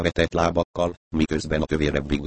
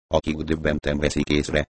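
ordinary delivery, nothing marked

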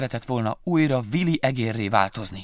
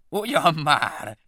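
laughing scorn; 7 semitones higher, 6 dB louder, much slower than usual. Acted. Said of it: "nevetett a denevér fennhangon".

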